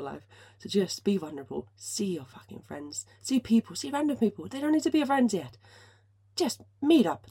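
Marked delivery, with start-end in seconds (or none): 1.8-3.1 slow, whispered swear for emphasis; emphatic whisper. Real, not acted. Host urges listeners to see friends, slow emphatic whisper. 3.2-7.3 higher and louder, building enthusiasm; excited urging. Real, not acted. Host urges listeners to meet people, excited higher louder urging.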